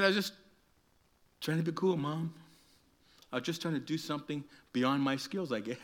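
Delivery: soft, halting excuse; sheepish hurt boy. Acted. Injured boy explaining himself to his mom, sheepish. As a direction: make a small, sorry excuse.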